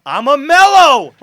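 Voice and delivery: high, drawn-out triumphant yell; mock boastful shout. Player jokingly owning the ball-stopper label, mock boastful shout. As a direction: holler it proudly, hammed up for laughs.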